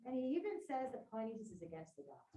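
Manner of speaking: ordinary talk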